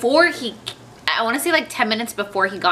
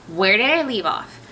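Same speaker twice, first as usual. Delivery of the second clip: ordinary talk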